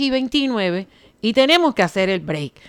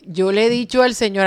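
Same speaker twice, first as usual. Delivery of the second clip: ordinary delivery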